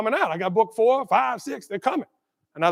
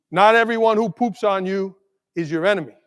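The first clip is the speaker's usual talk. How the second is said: ordinary talk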